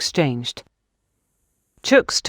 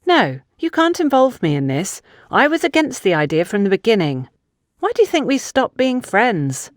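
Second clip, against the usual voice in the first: put-on higher, protesting young woman denying it; indignant young woman; 6 semitones higher than usual. Funke denying she helped plan a murder, indignant. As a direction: push back, offended at the very idea.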